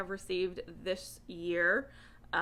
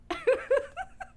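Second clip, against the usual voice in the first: high giggle; 5 dB louder than usual. Real, not acted. Laughing after the quote.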